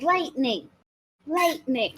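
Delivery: high, eager repeated cry; excited shout of Lightning. Retold or imitated. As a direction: yell it like a spellcaster. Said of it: "Zulu's just going there like".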